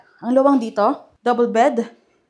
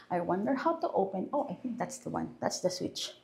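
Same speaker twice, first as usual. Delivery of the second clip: soft, half-voiced muttering while fumbling; muttering to herself; 4 semitones lower, 12 dB quieter than usual. Real, not acted. Looking for the lamp switch, muttering quietly to herself. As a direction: mumble under your breath as you search.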